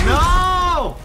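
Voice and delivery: high, loud drawn-out no; dismayed scream. Real, not acted. Gamer failing as damage dealer, dismayed scream.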